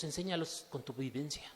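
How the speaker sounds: ordinary talk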